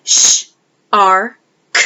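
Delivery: sh, r, k said as separate sounds; segmented sounds. Shown as a demonstration. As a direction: break shark into sh, r, k. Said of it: "To segment shark, we would say".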